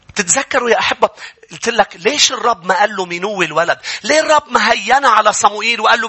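ordinary talk, nothing marked

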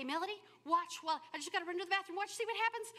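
eager husband calling out; husband urging. Retold or imitated. Husband leaving for the bathroom, eager and loud. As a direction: hurry her to watch the game. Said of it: "he would say".

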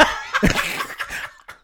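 breathy burst of laughter; real laugh. Real, not acted. Laughing at the old man's comeback, a real laugh.

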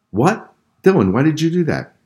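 high, sharp "What?" of disbelief; mother stunned by her son's charges; shocked mom. A narrator's reading. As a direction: react as if you can't believe it.

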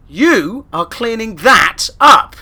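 stern order. Acted. Flatmate orders cleanup.

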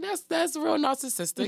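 high-pitched teasing reaction; mock disbelief. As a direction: pitch it way up as playful judgment. Mock reaction to [name]'s self-sample.

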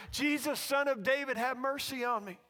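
loud imitated beggar's plea; calling out. Blind beggar begging Jesus for mercy, calling out. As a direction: call the plea over a crowd. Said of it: "he keeps yelling louder and louder".

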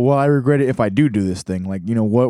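usual speaking voice

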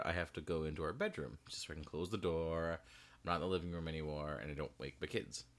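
normal talking voice

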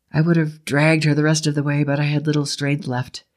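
usual speaking voice

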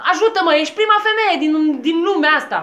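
high, loud, fast bickering; heated squabble. Acted. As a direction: push her to shout harder.

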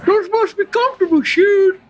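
high Southern simpleton, mouth full; Forrest Gump. Acted. Forrest admires the nurse's shoes, high voice, mouth full. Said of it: "He continues to shop with his mouth full".

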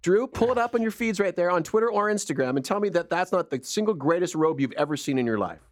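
ordinary talk, nothing marked

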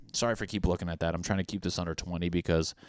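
ordinary delivery

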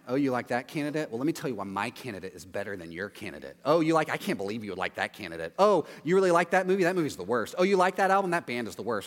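low, scornful one-upping; mock argumentative scoff. Argumentative fool mocking your candidate and music, low and scornful. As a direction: scoff at every taste they have. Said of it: "A fool tries to win an argument".

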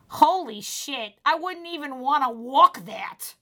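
loud, slow Boston waitress exclamation; Boston accent. Retold or imitated. Waitress reacting to marathon runners, loud Boston accent. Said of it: "she had a really thick Boston accent".

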